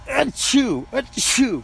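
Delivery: two high sharp sneezes; sneezing. Real, not acted. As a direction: sneeze for real, twice. Sneezes, "third time's a charm.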